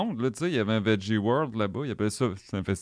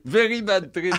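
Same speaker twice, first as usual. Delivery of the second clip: repeats Very Bad Trip high through a laugh; 10 semitones higher, 4 dB louder, slower than usual. Real, not acted. Amused by the French movie title, laughing.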